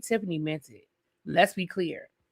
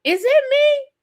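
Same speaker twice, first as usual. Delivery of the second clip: squeaky high, mock-innocent is it me; petty high question; 3 dB louder than usual. Host getting petty about Stormi with a high mock-innocent question. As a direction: ask it sky-high, playing innocent. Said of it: "I'm about to be a little petty".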